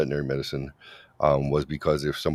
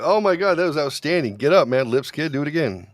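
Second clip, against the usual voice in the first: much higher, mock-ecstatic praise for a fallen kid; hyped-up cheering; 10 semitones higher, 4 dB louder than usual. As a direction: pump the kid up like a coach, overplayed. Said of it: "we go like".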